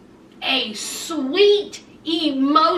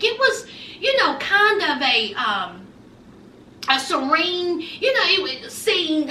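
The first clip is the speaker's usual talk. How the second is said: ordinary delivery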